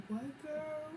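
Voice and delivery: like you can't believe she said it; incredulous. Retold or imitated.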